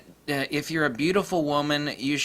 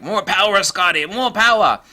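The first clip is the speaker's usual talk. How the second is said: loud, high mock starship command; hammed-up shout from a struggling camera; urgent shouted plea; 7 semitones higher, 9 dB louder than usual. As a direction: cry out desperately for more power, hammed up. Camera voiced as demanding captain.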